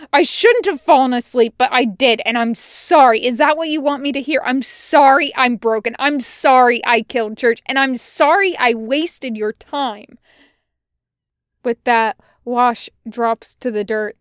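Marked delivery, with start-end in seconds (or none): 0.1-10.2 loud, agitated confession pouring out; yelled outburst. A narrator's reading. Wash confesses his failures to Tucker, yelling. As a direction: let the apologies pour out at a yell. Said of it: "Wash yells again".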